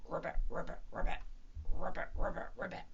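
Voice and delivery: frog croak. Acted.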